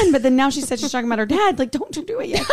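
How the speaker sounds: high-pitched, flustered retelling; mock-confused squeak edged with laughter; imitating confusion. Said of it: "Elaine was so confused".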